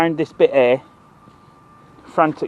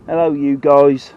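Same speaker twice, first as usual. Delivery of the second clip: drawn-out, louder American hello, lightly mocking; mock American greeting; 2 semitones lower, 6 dB louder, slower than usual. Shown as a demonstration. Fisherman mocking American talk, drawn-out American greeting. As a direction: stretch out guys like a mock American vlogger. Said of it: "Ain't how you speak in bloody Norfolk".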